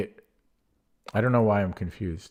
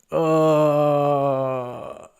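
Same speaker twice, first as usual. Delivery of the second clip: long, high, loud groan of regret; drawn-out regretful oh; 6 semitones higher, 6 dB louder than usual. Real, not acted. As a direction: let the oh hang in dismay. Regret over missed tactic.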